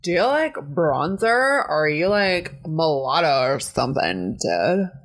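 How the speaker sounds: slow, laid-back surfer drawl; surfer dude. Acted. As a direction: stretch it out like a surfer bro. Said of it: "Next card is Surfer".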